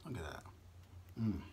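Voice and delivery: soft mumbled eating asides; quiet murmur. Real, not acted. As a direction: murmur it close to the mic.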